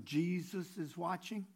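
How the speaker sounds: slow, quiet warning; a hushed, slightly drawn-out warning; mysterious unseen voice. Retold or imitated. Unseen voice warns a burglar in a joke, slow and hushed. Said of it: "he heard somebody say".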